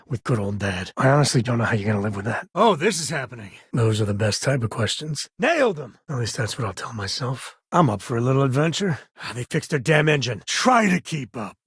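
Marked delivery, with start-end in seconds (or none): 5.4-6.0 sudden high burst of triumph; triumphant cheer. Acted. Gunner cheering a shot that hit, high triumphant cheer. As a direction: crow over a clean hit.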